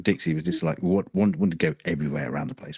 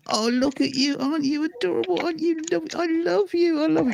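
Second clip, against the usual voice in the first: put-on very high, doting little old lady voice; cooing old lady. Old lady gushing over the dog in a very high coo. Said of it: "she comes over and goes".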